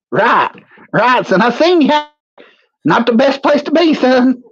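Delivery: a put-on hillbilly voice recounting the attack; character voice. Character voice again.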